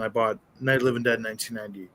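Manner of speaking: normal talking voice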